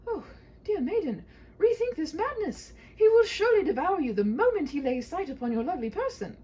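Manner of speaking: a bold knight calming a frightened maiden; gallant knight. Acted. Knight reassuring the maiden, high and grand.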